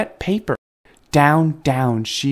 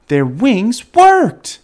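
high, loud, drawn-out delight; joyful storyteller exclamation; excited amazement; 9 semitones higher, 7 dB louder, much slower than usual. A narrator's reading. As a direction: announce the triumph slowly, voice soaring. Narrating triumphant first flight.

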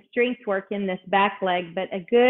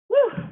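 relieved whew; 3 dB louder than usual. Real, not acted. Set finished.

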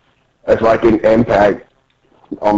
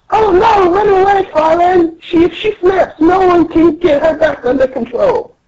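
panicking little Tontatas. Acted. Tontatas panic over the manager.

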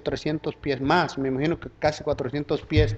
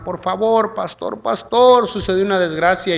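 imitated tearful plea; 6 semitones higher, 6 dB louder than usual. Church sister calls the pastor about a tragedy, imitated tearful plea. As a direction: mimic a distressed woman begging for help. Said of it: "llorando una hermana".

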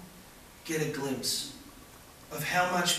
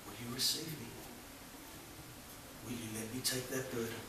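very soft, lower, fading to a whisper; tender invitation; 4 semitones lower, 10 dB quieter, faster than usual. Acted. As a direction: plead gently, almost whispering, my beloved. God's voice inviting the listener.

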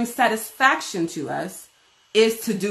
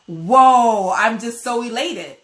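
elated whoa; 2 semitones higher, 6 dB louder, slower than usual. Pretend. Voicing elated believer.

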